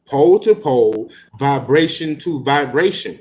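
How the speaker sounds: measured, deliberate book reading; reading aloud. Acted. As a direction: read it out like scripture. Reads passage from a book.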